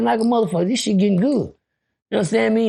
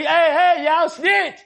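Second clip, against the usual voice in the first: higher-pitched yell to the guards; calling out; 10 semitones higher, much slower than usual. Retold or imitated. An inmate yelling to the guards to get off the block, high call.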